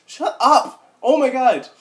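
high, loud gasp of disgust; loud, high, scandalised squeal; shocked outburst. Acted. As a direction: shriek it like a grossed-out teen. Reading a shocked fanfic outburst.

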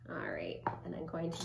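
soft whispered aside. Real, not acted. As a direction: breathe it out quietly.

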